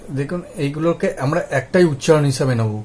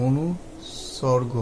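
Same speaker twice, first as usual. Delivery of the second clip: অনুসর্গ drawn out softly, part by part; slow, broken-up repeat; 7 dB quieter, much slower than usual. Shown as a demonstration. Teacher repeats a new term for learners, slowly. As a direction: say অনুসর্গ very slowly, split into pieces.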